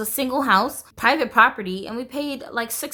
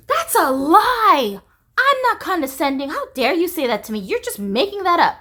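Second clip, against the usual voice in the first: hammed-up mom taking offence; mocking offended mom; 6 semitones higher, 4 dB louder than usual. Daughter imitating mom flipping out at criticism, high and indignant. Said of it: "You're very condescending".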